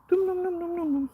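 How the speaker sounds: playful pet talk to a dog, high and hushed. Real, not acted. Cooing at dog, kisses.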